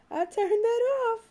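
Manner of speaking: high, sweet sing-song reassurance. Real, not acted. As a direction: lift it way up to coax a smile. Repeating to baby.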